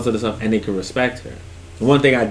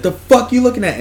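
put-on high, louder kid yelling; angry kid shout; 12 semitones higher, 5 dB louder than usual. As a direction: confront a kid across the room. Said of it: "He said".

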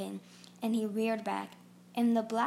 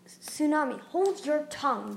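snapped; 5 semitones higher, 5 dB louder than usual. A narrator's reading. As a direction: bite it off as a stern rebuke. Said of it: "Dune snapped".

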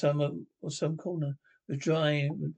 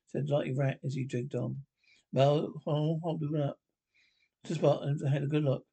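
plain reading voice

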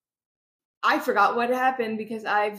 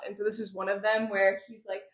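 usual speaking voice

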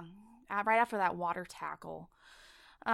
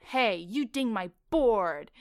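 slow, louder imitation of a yell; mock yell; 2 semitones higher, 6 dB louder, slower than usual. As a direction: imitate an angry surfer yelling, drawn out. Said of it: "He yells".

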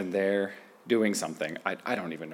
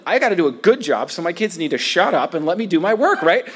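high and loud, building preacher fervor; impassioned preaching; 7 semitones higher, 4 dB louder than usual. Acted. Preacher confesses his own anger to the congregation, fired up. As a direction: preach it out at full force.

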